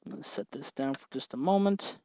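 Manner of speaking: ordinary talk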